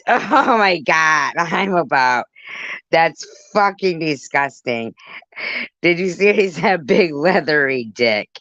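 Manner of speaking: high-pitched scandalized revulsion; shocked disgust. Real, not acted. Shocked disgust at viewer's revelation.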